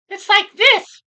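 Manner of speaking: very high, loud mock voice; no-bass voice. Streamer mocking Paul's immature voice, squeaky high imitation. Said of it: "He don't have any bass in his voice, no tone".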